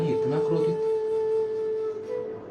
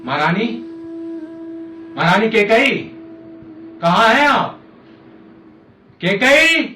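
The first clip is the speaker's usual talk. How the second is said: loud searching call, rising in pitch; 4 semitones higher, 4 dB louder than usual. Acted. King calling for his missing queen, calling out. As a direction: call out louder and higher for someone missing.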